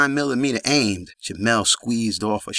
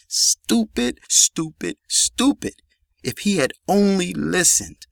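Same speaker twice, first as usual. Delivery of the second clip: grieving lament; 8 semitones higher than usual. A narrator's reading.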